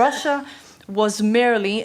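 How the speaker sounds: weight placed on "merely"; single out "merely" with deliberate emphasis, then pause; stress on MERELY. Real, not acted. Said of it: "emphasis on merely".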